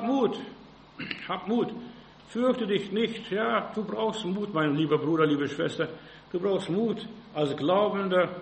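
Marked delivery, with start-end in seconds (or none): none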